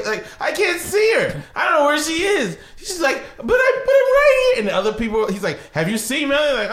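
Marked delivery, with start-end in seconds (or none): 0.4-2.6 high, innocent searching voice; feigned puzzlement. Retold or imitated. Older brother pretending little sister is invisible, high and puzzled. 3.4-4.6 mock tearful child. Little sister to brother ignoring her, wailing in mock distress. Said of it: "She starts crying". 5.7-6.6 high, innocent searching voice; feigned puzzlement. Retold or imitated. Older brother pretending little sister is invisible, high and puzzled.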